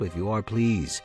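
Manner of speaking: ordinary delivery, nothing marked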